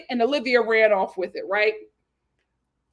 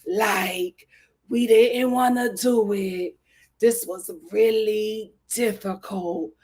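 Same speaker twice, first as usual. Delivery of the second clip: mock solemn Serena; 6 semitones lower than usual. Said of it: "she's just like".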